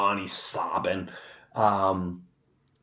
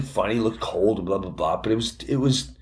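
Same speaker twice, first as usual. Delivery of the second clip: ordinary talk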